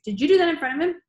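taken-aback serious mom; getting serious. A narrator's reading. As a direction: question him sternly, voice rising. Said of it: "said Mom, getting serious".

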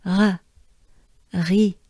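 "Ra, Ri" with tongue down, exhaling the R; isolated French R syllables "Ra, Ri". Shown as a demonstration. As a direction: breathe out through a French R in "Ra, Ri". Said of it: "it stays put while you exhale".

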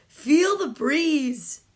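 slow and faraway, like lost in a daydream; dreamy voice. A narrator's reading. Max talking to himself on the first day of spring, dreamy and slow. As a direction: drift through it slowly, dazed. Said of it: "he read it in a dreamy voice".

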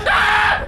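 high terrified shriek; real scream. Real, not acted. Player jump-scared by the game, screaming. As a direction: scream out in shock. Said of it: "I'm sorry I'm screaming so much".

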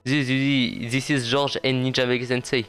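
put-on slow, measured reading in French-accented English. Gamer reads Zizi's cutscene dialogue aloud, French-accented English. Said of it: "Magnifique accent anglais".

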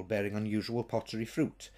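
plain reading voice

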